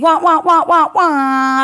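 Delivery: loud wah wah air horn noise; mouth air horn. Shown as a demonstration. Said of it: "Can you show me?".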